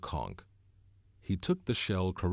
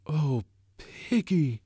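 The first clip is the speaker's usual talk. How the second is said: slow, stricken cry, pitch raised; 9 semitones higher, 5 dB louder, much slower than usual. A narrator's reading. Ralph cutting into Piggy's excuses, stricken. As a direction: draw it out in anguish. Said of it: "low and stricken".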